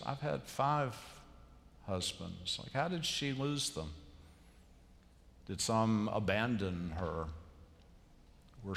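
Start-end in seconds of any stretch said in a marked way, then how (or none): 0.0-2.6 a hint of hurt, fading softer at the end; pained. Preacher voicing the woman's confession to Jesus, mildly pained. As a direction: let the pain show, trailing off quietly. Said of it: "can you not hear the pain in her voice".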